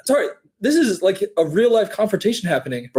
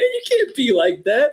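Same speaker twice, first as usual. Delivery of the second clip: ordinary delivery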